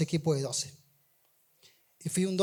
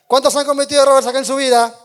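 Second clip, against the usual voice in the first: loud, high call to the whole room; rousing question; 7 semitones higher, 12 dB louder than usual. Real, not acted. Preacher challenging his listeners, loud and high. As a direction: call out to the congregation.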